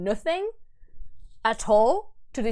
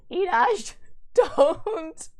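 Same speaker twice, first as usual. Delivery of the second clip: loud, high plea; pleading outburst; 7 semitones higher, 5 dB louder, much slower than usual. Real, not acted. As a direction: beg the hero to stop. Pleads with story character.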